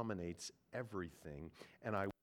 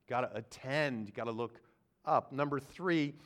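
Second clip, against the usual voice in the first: ordinary talk, nothing marked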